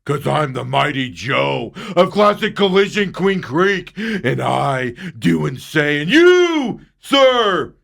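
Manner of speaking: booming, slow, self-important tyrant; hammed-up mighty mechanic making demands; pompous body shop owner skit. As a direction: lord it over the customer, drawing out each demand. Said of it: "doing that skit right there".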